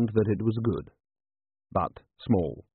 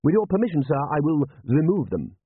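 higher-pitched, lightly voiced butler; deferential butler; 6 semitones higher than usual. A narrator's reading. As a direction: politely ask leave to get on with it. Butler addressing his commander.

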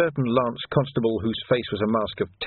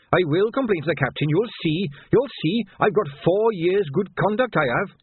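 Quirk blustering; 9 semitones higher than usual. Acted. Quirk threatening Vimes he will complain to the captain. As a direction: huff up and threaten the boss.

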